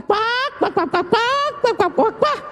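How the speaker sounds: high squeaky quack quack mocking chatter; mock duck quacking. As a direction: quack like gossiping ducks to mock them. Mocking gossiping mourners. (graznidos burlones de pato, agudos)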